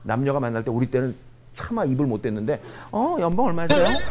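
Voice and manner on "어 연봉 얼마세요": high, pert mock question; mimicked young dater. Young date asking a man his salary, high mimicked voice. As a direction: pipe up like a nosy date. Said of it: "요즘 애들은 이걸 물어봐요".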